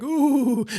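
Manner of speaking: high, drawn-out "ooh"; mock crowd ooh. Everybody watching from the restaurant windows, high mock ooh. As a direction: imitate onlookers with a long high ooh.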